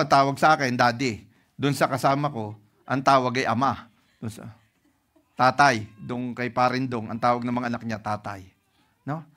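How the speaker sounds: usual speaking voice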